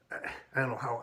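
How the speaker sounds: ordinary talk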